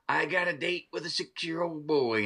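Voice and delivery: a hint of a sinister doll; lightly voiced Chucky. Doll promising to get the boy, lightly voiced sly menace. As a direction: say it slyly as the doll. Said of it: "he said a line".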